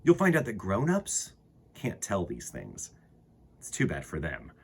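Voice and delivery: ordinary delivery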